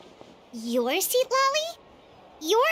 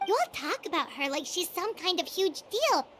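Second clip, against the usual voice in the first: usual speaking voice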